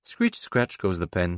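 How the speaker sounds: usual speaking voice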